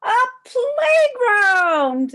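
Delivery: high, loud, drawn-out joyful shout. A narrator's reading. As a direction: cry it out together, thrilled. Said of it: "they shouted".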